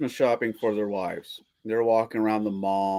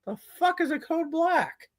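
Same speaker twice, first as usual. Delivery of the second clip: high, incredulous squeak, softer; baffled reaction; 4 dB quieter than usual. Retold or imitated. Reacting to a hospital show's code, baffled. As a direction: squeak out disbelief at the jargon.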